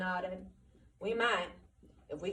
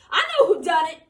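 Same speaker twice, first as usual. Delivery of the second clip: high, loud raging accusation; furious shout; 11 semitones higher, 12 dB louder than usual. Acted. Curly accusing Lenny over the body, furious. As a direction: scream out who did it. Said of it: "He worked himself into a fury".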